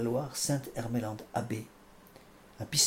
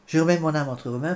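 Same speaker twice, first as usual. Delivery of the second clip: cried out louder and higher, full voice; loud cry; 4 semitones higher, 10 dB louder than usual. Acted. Preacher acts out Jesus crying his last words, loud cry. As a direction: call it out as Jesus did on the cross. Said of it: "qu'il cria d'une voix forte".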